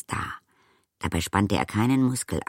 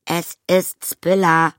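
high, slow, raised voice; loud, drawn-out introduction; louder repeat; 11 semitones higher, 7 dB louder, slower than usual. A narrator's reading. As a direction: repeat it louder so she gets it. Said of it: "wiederholte Arietti etwas lauter".

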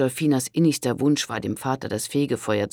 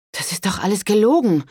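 higher, louder blurted protest; indignant outburst; 8 semitones higher, 7 dB louder than usual. A narrator's reading. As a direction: burst out in disbelief. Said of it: "entfuhr es Pandolfina". (empörte Pandolfina)

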